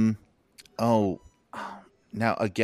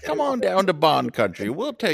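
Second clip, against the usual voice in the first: high, hammed-up country welcome; mock southern invitation, laughing; folksy host voice; 11 semitones higher, 5 dB louder than usual. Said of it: "homey kind of Bond podcast".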